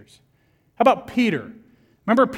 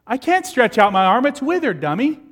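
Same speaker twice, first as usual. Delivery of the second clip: indignant retort; 6 semitones higher, 6 dB louder than usual. Pretend. Healed man talking back indignantly.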